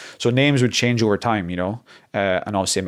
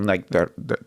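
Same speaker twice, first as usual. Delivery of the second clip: ordinary talk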